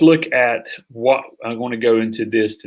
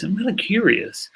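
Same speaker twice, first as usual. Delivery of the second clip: curious tone; 8 semitones higher than usual. Shown as a demonstration. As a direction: voice lifts high with real curiosity. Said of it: "then I want to bring that curiosity".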